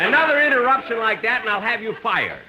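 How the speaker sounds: high, loud angry threat; angry at the interruptions. Acted. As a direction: blow up at him. Losing temper at interruption.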